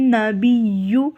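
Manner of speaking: slow نبیو with doubled ya; slow nabiyyu. Shown as a demonstration. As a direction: stretch نبیو, stress the ya. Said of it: "اس کو بیو پڑھیں گے". (نبیو تشدید کے ساتھ)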